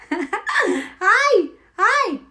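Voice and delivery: high, loud hi through giggles; excited greeting. Real, not acted. Greeting a viewer on the stream, giggly squeal. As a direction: squeal hello, delighted and loud.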